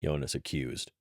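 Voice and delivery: ordinary delivery, nothing marked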